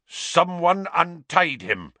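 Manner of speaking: high, slow angry snarl. A narrator's reading. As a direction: snarl the accusation. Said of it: "the executioner was snarling".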